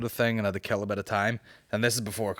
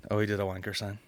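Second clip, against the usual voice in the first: usual speaking voice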